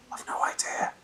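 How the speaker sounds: soft unvoiced whisper; sneaky hushed aside. A narrator's reading. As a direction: mutter from the corner of the mouth. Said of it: "from the corner of her mouth".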